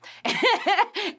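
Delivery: a bright giggle breaking out; high burst of laughter. Real, not acted. Vlogger laughing at the character's name.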